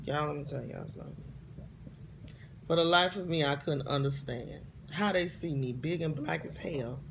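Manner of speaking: ordinary delivery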